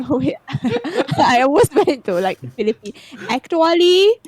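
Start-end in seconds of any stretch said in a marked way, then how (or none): 3.3-4.1 Filipino accent attempt. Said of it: "I can't do a Philippine accent".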